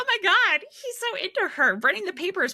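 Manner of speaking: high, loud squealing fangirl voice; gushing new reader. Acted. New readers swooning over a romance, gushing excitedly. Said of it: "new readers, I think".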